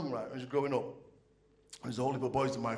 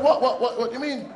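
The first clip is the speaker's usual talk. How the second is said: loud, higher, stammering disbelief; bewildered son; 10 semitones higher, 9 dB louder than usual. Retold or imitated. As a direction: shout your confusion at your father.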